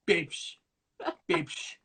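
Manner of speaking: a soft, lazy impression of a friend; imitating Lawrence. Mimicking his friend's lazy babes. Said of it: "where you impersonated me".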